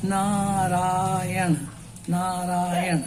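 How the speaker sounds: Narayan drawn out, syllables apart; teacher reads Narayan slowly to show syllables; slowly broken. Shown as a demonstration. Slow syllable demo. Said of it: "उसको slowly तोड़ते हुए चले जाएं".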